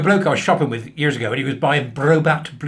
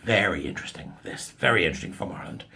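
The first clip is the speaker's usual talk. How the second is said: a lower, hushed, portentous tone; mock gravity; 8 semitones lower, 5 dB quieter than usual. Setting up the Irish news for viewers, low and mock-solemn. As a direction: drop into a mock-serious hush.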